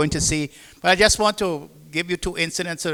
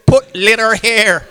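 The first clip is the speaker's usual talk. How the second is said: slow, louder broken-English reading; mock sign reading; 4 semitones higher, 10 dB louder, slower than usual. Retelling the can sign, slow and louder. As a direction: spell out the sign slowly again for the laugh. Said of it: "he said".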